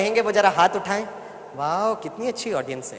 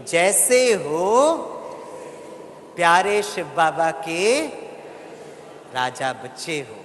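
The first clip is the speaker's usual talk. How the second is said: प्यार भरा आशीर्वाद; slower than usual. Shown as a demonstration. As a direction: lead the room lovingly, pausing between phrases. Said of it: "सितारे को देखकर बोलिए". (प्यार से खींचकर, अंत में धीमी कोमल आवाज़)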